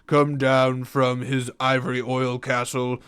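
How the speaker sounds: dry, humorless critic voice; pretentious critic. Acted. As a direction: sniff at it like a snooty reviewer. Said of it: "that would be my, uh, very, very opinionated and dry and, uh, humorless, pretentious critic".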